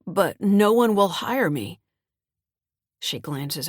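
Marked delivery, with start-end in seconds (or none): 0.1-1.8 slow, whining plea; whiny voice. A narrator's reading. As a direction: whine it like a sulky teen. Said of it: "I say in a whiny voice".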